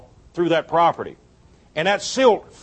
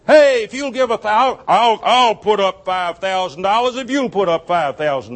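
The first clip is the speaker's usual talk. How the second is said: a hint of a pushy fundraiser, higher and louder; mock fundraiser pitch; 3 semitones higher, 4 dB louder than usual. Preacher mocking a matching-gift appeal, lightly hammed-up salesman voice. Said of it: "What is matching giving?".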